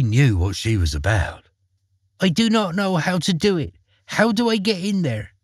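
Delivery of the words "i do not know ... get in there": put-on high, wide-eyed little girl voice; innocent Gretel. A narrator's reading. Gretel feigning innocence to the witch, high and wide-eyed. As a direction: play dumb like an innocent child. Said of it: "her eyes wide and innocent".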